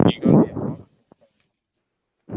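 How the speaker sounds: breathy whispered repeat; whispered echo. Shown as a demonstration.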